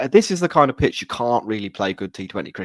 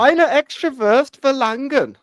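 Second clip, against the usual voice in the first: slow, high put-on German accent; exaggerated German, drawn out high; 13 semitones higher, 4 dB louder, slower than usual. Shown as a demonstration. Hammed-up German accent for the co-hosts. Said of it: "my German accent".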